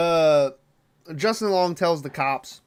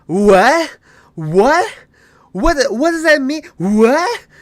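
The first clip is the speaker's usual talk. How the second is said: loud, high, mocking "what?"; hammed-up disbelief, much louder; exasperated confused imitation; 10 semitones higher, 7 dB louder, slower than usual. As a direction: imitate someone baffled, each question shooting up high. Imitating character stuck on confused questions.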